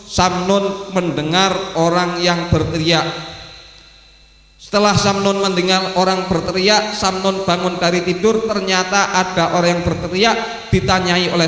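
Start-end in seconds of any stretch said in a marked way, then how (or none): none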